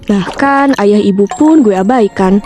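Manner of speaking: ordinary delivery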